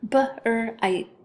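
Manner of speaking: "B-R-I-T" as b, r, long i, t; tapped out. Shown as a demonstration. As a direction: break "B-R-I-T" apart sound by sound. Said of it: "have you tap it out".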